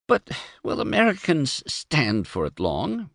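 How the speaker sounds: high, frightened whimper of a worried wife. A narrator's reading. Wife fearing the new regime, whimpering. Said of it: "whimpered Emma".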